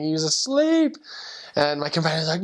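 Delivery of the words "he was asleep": a high, giddy reveal to a friend; amused disbelief. Retold or imitated. To companion, about the sleeping investigator: high giddy surprise. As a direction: pitch jumps way up on asleep. Telling companion he fell asleep.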